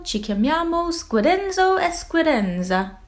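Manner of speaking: put-on high, bright Italian accent squid twins; chirpy Italian, quick and friendly. Squid twins introducing themselves to Squiddy in Italian accent.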